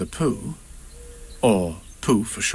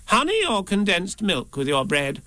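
high-pitched host's offer; Rabbit; 8 semitones higher than usual. A narrator's reading. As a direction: offer food in a high creature voice. Rabbit offers Pooh food.

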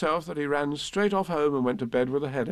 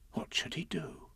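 hushed, much softer storytelling; hushed wondering; 12 dB quieter than usual. A narrator's reading. Narrator puzzling over Piglet's dilemma, hushed. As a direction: whisper it like a secret worry.

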